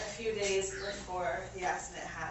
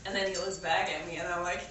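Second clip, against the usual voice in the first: ordinary talk, nothing marked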